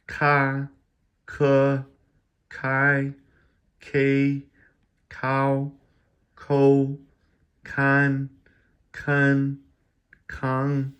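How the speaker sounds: each syllable called out loud and high, slowly spaced; drilling syllables. Shown as a demonstration. Teacher drilling Chinese initials for viewers, loud high enunciation. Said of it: "the H, remember".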